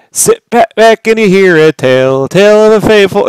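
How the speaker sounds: loud, playful song blurting; yelled lyric. Retold or imitated. Husband blurting lyric at wife, playful yelling. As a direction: yell out the theme-song line. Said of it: "I just yell out".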